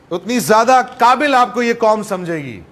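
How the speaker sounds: ordinary talk, nothing marked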